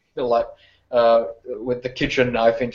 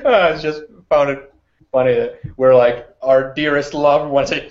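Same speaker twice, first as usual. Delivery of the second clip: normal talking voice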